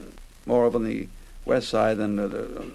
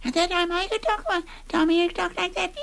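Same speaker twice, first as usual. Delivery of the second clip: put-on high little-girl voice, much higher; little Betty Lou voice. Shown as a demonstration. Old host demoing Tommy's girl voice for the interviewer, high little-girl squeak. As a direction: pipe up like a small girl doll. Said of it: "my voice can't do it too well today".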